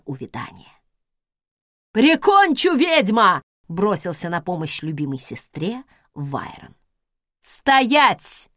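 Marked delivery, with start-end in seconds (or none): 1.9-3.4 loud, charging battle cry; furious yell. Acted. Brother charges to attack. 7.4-8.5 loud, sharp order to halt; sharp command. Acted.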